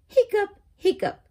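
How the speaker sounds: loud high hiccups, fading; high yelped hiccups. Acted. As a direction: burst out two hiccups. Kitty hiccups in story.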